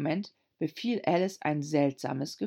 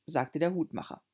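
ordinary delivery, nothing marked

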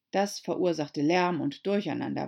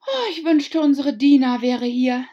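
high, much louder wish; said aloud; 8 semitones higher, 12 dB louder than usual. A narrator's reading. Alice wishing aloud, high and loud. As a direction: call it out to no one. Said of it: "sagte Alice laut".

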